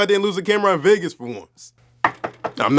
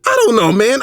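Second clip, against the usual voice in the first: ordinary talk, nothing marked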